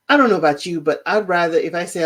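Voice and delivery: ordinary talk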